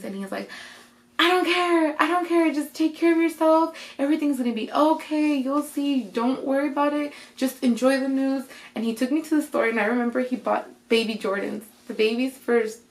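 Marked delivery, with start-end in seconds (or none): 1.2-8.4 high-pitched, emphatic reassurance; excited insistence. Retold or imitated. Dad tells worried daughter to enjoy news, excitedly insistent. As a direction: imitate Dad brushing worries aside happily.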